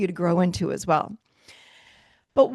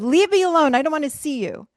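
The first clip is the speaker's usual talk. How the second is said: higher mock snap, easing off; overwhelmed mom; 5 semitones higher than usual. Overwhelmed mom to her excited kid, acted rebuff. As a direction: act out a mom pushing a child away. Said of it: "she's overwhelmed and she pushed you away".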